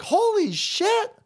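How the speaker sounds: high, slow, drawn-out disbelief; Norman's slow high gasp of shock; mock stunned Norman. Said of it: "even Norman's like".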